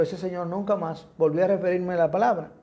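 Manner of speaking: usual speaking voice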